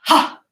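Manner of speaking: sharp loud karate kiai; karate yell. Shown as a demonstration. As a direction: bark out a karate chop shout. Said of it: "Do it again".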